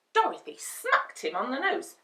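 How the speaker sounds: ordinary delivery, nothing marked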